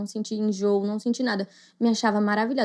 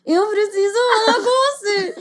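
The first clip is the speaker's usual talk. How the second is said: urgent plea; 10 semitones higher, 4 dB louder than usual. Retold or imitated. Retold urgent confession to sister.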